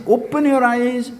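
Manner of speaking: put-on child ordering grandpa to look; bossy child. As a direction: command it like an exasperated little boy. Voicing the small child.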